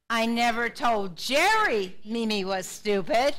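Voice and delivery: slow sentence, lean hard on Jerry; stress on Jerry. Shown as a demonstration. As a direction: make Jerry the word that jumps out. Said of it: "putting the emphasis on a different word".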